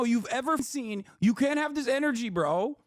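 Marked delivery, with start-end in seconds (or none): none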